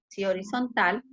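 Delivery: ordinary talk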